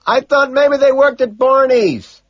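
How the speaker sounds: slow, high, loud yell; full-volume holler; screaming. A narrator's reading. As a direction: shout it word by word over a crowd. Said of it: "I scream".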